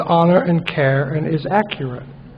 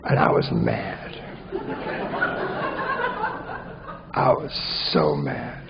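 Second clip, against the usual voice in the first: hushed confession; 11 dB quieter than usual. Real, not acted. Confessing anger, dropped voice.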